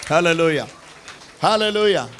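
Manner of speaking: fervent preacher calling out to the church; rousing, ringing call to the crowd; preaching at full force. Real, not acted. Pastor stirring the congregation, fervent call.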